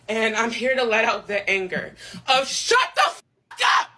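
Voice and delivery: sobbing, furious shout; angry crying. Real, not acted. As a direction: yell it through tears.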